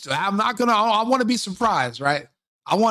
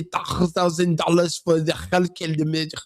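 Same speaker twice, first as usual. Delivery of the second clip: slipping into a mock Somali accent; slow, badly faked Somali accent; fake Somali accent; slower than usual. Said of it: "I have the Somali accent".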